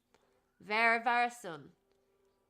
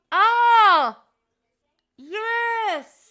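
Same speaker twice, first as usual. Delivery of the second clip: higher, louder delight; thrilled at a donation; excited; 9 semitones higher, 13 dB louder than usual. Real, not acted.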